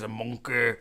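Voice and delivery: lightly imitated comedian's catchphrase; a hint of a comic's way of saying it; Johnny Vegas impression. Recalling who said the toy's name, lightly mimicked comedian. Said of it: "I think it was Johnny Vegas".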